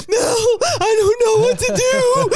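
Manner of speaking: high, loud panicked wail imitating a crying man; hammed-up imitation of a frantic crying call. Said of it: "it was like".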